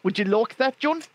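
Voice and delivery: put-on South African, questioning lilt; South African accent. Shown as a demonstration. Host inviting John for a smoke, South African accent. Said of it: "in order to do a South African accent".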